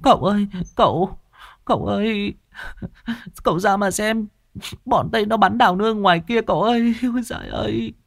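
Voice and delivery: high, whiny crying voice; whimpering young woman. A narrator's reading. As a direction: fake a tearful wail to the young master. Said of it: "Con ả nó mếu máo khóc".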